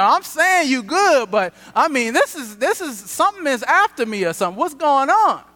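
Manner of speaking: half-laughing protest to God; a pastor pleads with God over his wrecked car, loud and high; exasperated plea to God. Retold or imitated. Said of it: "You know".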